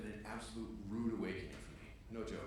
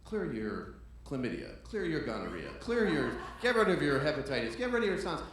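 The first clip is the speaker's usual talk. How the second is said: mock drug-ad pitch building louder; over-the-top commercial announcer; parody TV ad; 3 semitones higher, 8 dB louder than usual. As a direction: sell it like an ad, rising to a shout.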